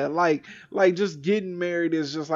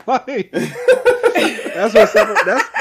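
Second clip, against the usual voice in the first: laughing; 3 semitones higher, 6 dB louder, much slower than usual. Real, not acted. Laughing mid-sentence.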